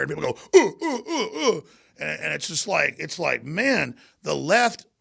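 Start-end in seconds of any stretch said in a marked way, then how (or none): 0.6-1.6 high, mocking ooh sounds; mock hooting. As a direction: hoot like a silly crowd. Mocking people's reaction.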